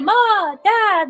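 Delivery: put-on cooing baby voice. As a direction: play the innocent baby for the parents. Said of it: "back into this, like, la la".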